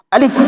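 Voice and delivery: আলিফ said crisply with air pushed out through the lips; letter demo. Shown as a demonstration. Teacher showing students how to say আলিফ, crisply. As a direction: sound out আলিফ clearly for the students. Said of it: "আলিফটা উচ্চারণ করার ক্ষেত্রে".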